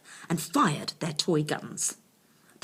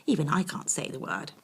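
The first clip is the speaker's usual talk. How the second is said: ordinary delivery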